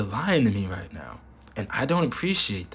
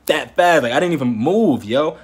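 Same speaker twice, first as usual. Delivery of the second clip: indignant; 4 semitones higher, 8 dB louder than usual. Real, not acted. Indignant punchline of story.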